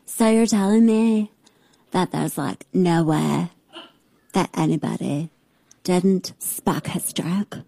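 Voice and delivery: imitating the guest's slow vocal fry; mocking vocal fry. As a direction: put on her creaky drawl. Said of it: "I should be her for Halloween".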